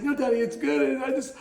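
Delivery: high-pitched imitation of a crying son; retold tearful child. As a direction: voice a tearful little boy, reassuring his dad, pitched well up. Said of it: "he began to just get cry".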